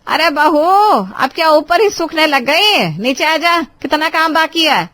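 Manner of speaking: loud, high summoning shout; bossy mother-in-law calling upstairs; calling from downstairs. Acted. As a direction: holler up to the roof impatiently. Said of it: "उसकी सास ने उसे नीचे से आवाज".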